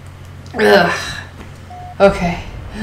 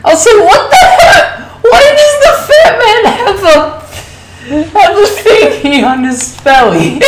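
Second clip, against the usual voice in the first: high, loud laughing shout; yelling in amused disbelief; shocked shout; 10 semitones higher, 4 dB louder than usual. Real, not acted. Shocked at fat man's belly.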